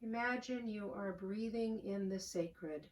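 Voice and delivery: calm, unhurried voice with long pauses; soothing prayer-meditation delivery, phrase by phrase; guided meditation. Real, not acted. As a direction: guide them gently into the breath, softening as you go. Leads a guided breathing meditation.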